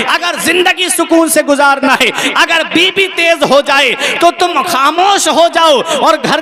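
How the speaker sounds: high, driving sermon exhortation; preaching. Acted. Preacher urging husbands to stay silent, impassioned preaching. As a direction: build into an urgent appeal to the congregation.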